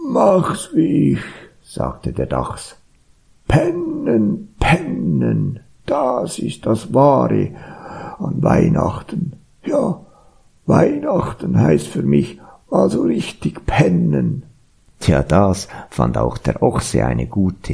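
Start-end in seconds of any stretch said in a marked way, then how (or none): 0.1-1.2 high, put-on badger voice; stretched-out animal character voice. A narrator's reading. 3.5-14.4 lazy badger character, pitched up; sleep-loving badger. A narrator's reading.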